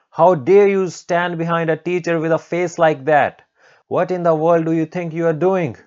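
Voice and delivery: high, loud indignant scolding; screaming outrage. Acted. Teacher berates student standing behind her, outraged yelling. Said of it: "Haruko started screaming".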